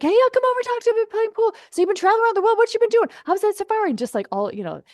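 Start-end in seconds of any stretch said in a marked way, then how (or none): 0.0-3.9 much higher, louder upbeat buddy voice; a new friend on the phone inviting her to the bar, high and excited; imitated excited friend. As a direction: mimic a friend gleefully calling you over. Boyfriend greeting her at bar.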